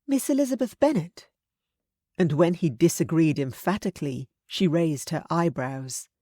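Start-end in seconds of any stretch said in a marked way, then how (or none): 0.1-1.3 higher, firm matronly voice; stern aunt. A narrator's reading. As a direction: scold him like a frank aunt.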